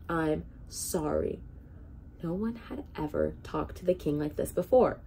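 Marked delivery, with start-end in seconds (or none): 0.1-1.4 slow and pointed. Acted. Spelling out the words for king.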